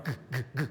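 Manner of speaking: g said as a quick voiced stop, no vowel after; short g demo. Shown as a demonstration. Teacher shortening the sound into g. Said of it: "then you shorten that up".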